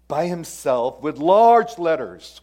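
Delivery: slow, loud, drawn-out emphasis; emphatic. Real, not acted. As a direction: hammer home each word slowly. Preacher stresses Paul's own handwriting.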